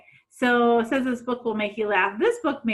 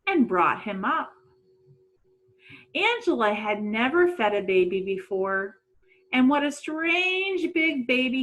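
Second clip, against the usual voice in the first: lively read-aloud storytelling voice; story narration; 4 dB louder than usual. A narrator's reading. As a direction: read it like a storybook to young kids.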